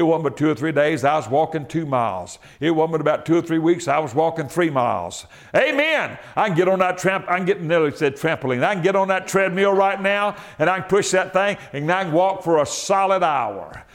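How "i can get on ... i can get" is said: ordinary delivery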